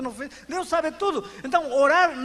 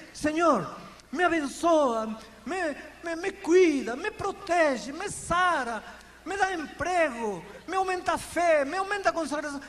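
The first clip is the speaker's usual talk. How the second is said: routine pleading prayer; 6 semitones higher than usual. Retold or imitated. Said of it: "A gente às vezes diz".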